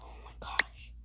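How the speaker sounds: awed whisper. Real, not acted. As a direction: breathe it out in awe. Amazed whisper while playing.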